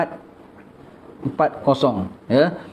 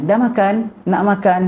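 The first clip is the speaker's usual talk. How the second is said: higher-pitched mock offer of food; imitated host's question; 6 semitones higher than usual. Showing what not to ask a visitor, high imitated questioning. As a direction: lightly act out asking a guest, pitch lifted. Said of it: "tak, jangan tanya".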